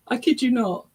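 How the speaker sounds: ordinary talk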